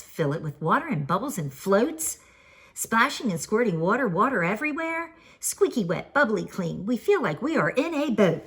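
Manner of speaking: usual speaking voice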